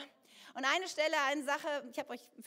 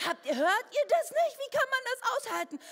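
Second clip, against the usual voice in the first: much higher and louder disbelief; can't believe nobody else hears it; indignant outburst; 10 semitones higher, 9 dB louder than usual. Retold or imitated. Churchgoer glaring at the others, indignant.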